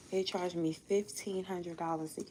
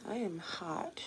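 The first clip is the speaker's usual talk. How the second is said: whispered aside to herself; unvoiced murmur of being hot; 7 dB quieter, slower than usual. Real, not acted. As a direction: breathe it out as a quiet complaint.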